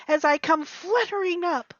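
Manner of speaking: ordinary delivery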